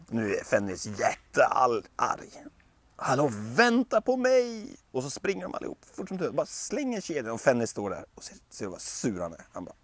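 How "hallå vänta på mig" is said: higher, slow desperate wait for me; panicked call. Acted. A god left behind calling the others, panicked.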